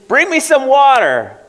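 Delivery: high and loud demand; demanding yell. Acted. Mother ordering her son to bring water, high loud demand. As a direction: bark the order from bed.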